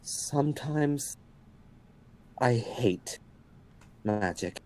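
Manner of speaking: slow, quiet, weary droll line; deadpan. Real, not acted. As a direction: mutter it dry and tired. Deadpan after near death.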